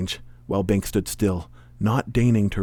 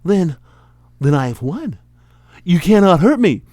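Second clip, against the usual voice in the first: halting, raised-pitch dawning amazement; amazed; 8 semitones higher, 7 dB louder than usual. Acted. Hero realising he has won, amazed. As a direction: let the victory dawn, then say it louder. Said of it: "Bink was amazed".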